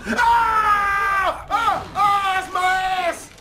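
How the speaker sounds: high yells of pain and disgust; pained yelling. Real, not acted. Gamer yelling in pain while getting attacked. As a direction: yell out as you get hit.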